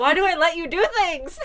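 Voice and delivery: laughing in disbelief. Real, not acted. Exasperated laughing at cohost.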